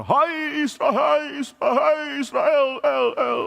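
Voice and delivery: high call fading into echo. Acted. Goliath's shout echoing into the Israelite camp, fading echo. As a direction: let it ring back from the hills. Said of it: "Suaranya menggema kemana-mana".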